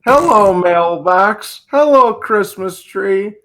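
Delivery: high, drawn-out hammed-up hellos; mock movie greeting. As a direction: hail each object slowly in a high, hammed-up voice. Mock greetings after classic film named.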